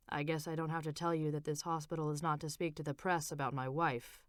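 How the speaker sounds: low, soft husband voice; husband instructing the nurse, low and soft; Frank's voice. A narrator's reading.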